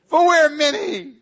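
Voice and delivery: high, loud, slow demon boast. Acted. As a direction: draw it out proudly in a high demon voice.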